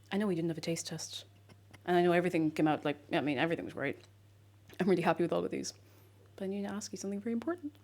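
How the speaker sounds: usual speaking voice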